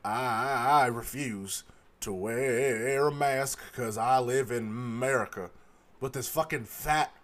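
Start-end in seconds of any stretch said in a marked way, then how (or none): 0.0-5.5 lightly voiced defiant man; imitating the mask refuser. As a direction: drag it out like a stubborn customer. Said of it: "He said it just like that".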